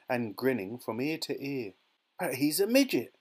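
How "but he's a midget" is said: raised, louder exclamation; shocked shout. A narrator's reading. Father sees his shrunken son, shouting. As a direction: shout it in dismay. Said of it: "shouted Mister T V".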